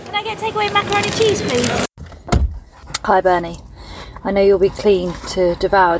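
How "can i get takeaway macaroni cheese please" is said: normal talking voice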